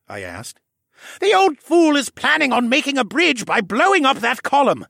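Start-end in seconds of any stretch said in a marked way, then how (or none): 1.2-4.9 loud, high, forceful outburst; exasperated bark. A narrator's reading. Jenner explaining Tovish's plan to Alex, barking. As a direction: bark the explanation with scorn for the old fool. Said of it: "Jenner barked".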